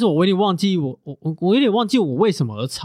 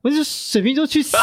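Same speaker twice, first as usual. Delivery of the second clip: laughing; 9 semitones higher than usual. Real, not acted. Jokingly damns Aquarius exes.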